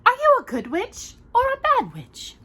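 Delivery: high sweet good-witch voice; Glinda voice. Acted. Glinda questioning Dorothy, high sweet voice. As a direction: float it up like Glinda. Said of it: "Glinda asked Dorothy".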